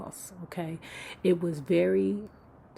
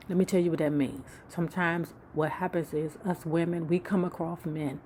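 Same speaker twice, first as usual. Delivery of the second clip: ordinary talk, nothing marked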